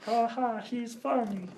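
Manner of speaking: high-pitched frog puppet voice; Kermit the Frog. Retold or imitated. Podcaster redoing the director as a puppet frog, high impression. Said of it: "I need to do Kermit the Frog".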